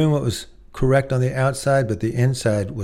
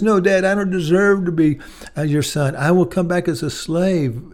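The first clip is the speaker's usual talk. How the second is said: higher, humble protesting son; pleading son; 6 semitones higher than usual. Acted. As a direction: push back, begging to be a slave. Prodigal son pleading with father.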